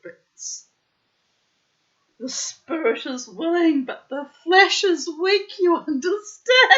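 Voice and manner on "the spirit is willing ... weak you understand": words breaking into high laughter; laughing through it. Real, not acted. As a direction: laugh your way through the proverb, rising. Laughs through the quoted saying.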